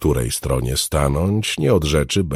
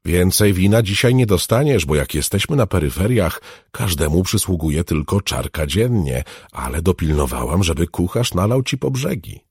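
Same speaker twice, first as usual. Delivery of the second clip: ordinary delivery, nothing marked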